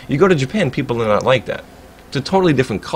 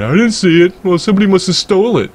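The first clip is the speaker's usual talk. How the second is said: mocking their lie; 7 semitones higher, 5 dB louder than usual. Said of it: "motherfuckers just straight up lying".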